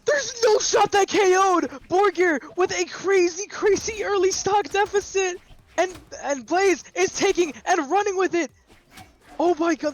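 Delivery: high, excited play-by-play; esports caster. Acted. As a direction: hype the comeback live.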